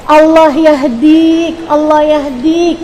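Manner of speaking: slow, drawn-out blessing instead of a curse; Tarim parents' gentle scolding. Retold or imitated. Angry Tarim mother blessing her child, slow and drawn out. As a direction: stretch each syllable out very slowly. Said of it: "kalau lagi marah, cuman ngomongnya kayak gini".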